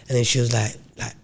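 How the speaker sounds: ordinary talk, nothing marked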